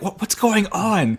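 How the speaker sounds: mock confusion. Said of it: "We're like".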